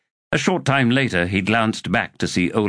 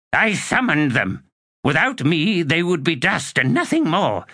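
higher, imperious witch voice; proud sorceress; 9 semitones higher than usual. A narrator's reading. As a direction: assert ownership haughtily. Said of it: "Olar Ethil asserts her power".

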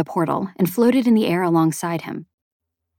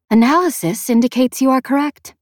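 higher-pitched feminine AI; feminine sphere voice; 6 semitones higher, 3 dB louder than usual. A narrator's reading. Sphere confirms the man's finding, higher feminine AI voice. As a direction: report it as an artificial feminine voice, pitched up. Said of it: "a feminine voice said".

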